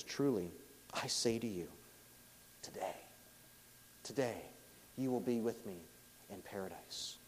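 soft, quiet, dying words with pauses; hint of a man breathing his last; a touch of breathlessness. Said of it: "He's probably out of breath".

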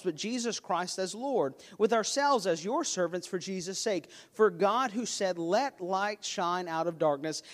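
usual speaking voice

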